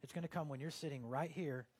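normal talking voice

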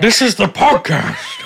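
loud, high, slow announcer voice; hammed-up announcer. Host announcing to the guests, mock grand and loud. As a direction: announce it like a cheesy show host.

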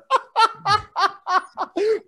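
high-pitched burst of laughter. Real, not acted. Laughing after retold outburst.

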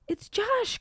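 high-pitched storybook cry, held back in volume; mock cry of alarm. A narrator's reading. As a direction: cry out high without shouting. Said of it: "cried Christine".

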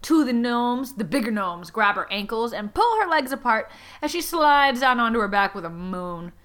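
normal talking voice

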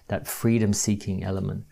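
usual speaking voice

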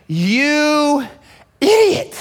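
sharp high jab at yourself, going up on idiot; self-scolding. Pretend. Looking in the mirror, scolding yourself: mock contempt.

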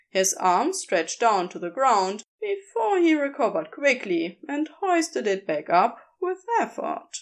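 ordinary delivery

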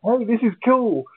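very high, gleeful hey hey; retold childlike glee; giddy delight. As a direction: marvel at the machines like a kid. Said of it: "And I'm like".

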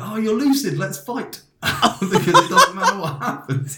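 high, loud, excited mock voice of the friend, giggling at the end; mock eager friend. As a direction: play the overeager friend spoiling for a fight, up high and laughing. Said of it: "And you were like".

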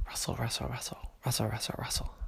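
a hushed sound effect in the woods; soft rustling. A narrator's reading. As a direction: breathe it out like leaves rustling.

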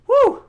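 sudden loud, high "woo"; exhaling high whoop. Real, not acted. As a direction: let out a short whoop. Own exclamation after painful memory.

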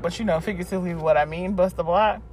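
ordinary talk

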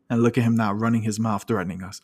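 ordinary talk

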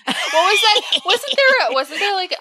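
high giggly rush of excitement; excited. Real, not acted. Laughing, squeaky high excitement.

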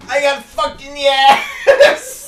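slow, high, loud wail; wailing cry. Acted. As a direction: wail it in misery. Injured victim wailing.

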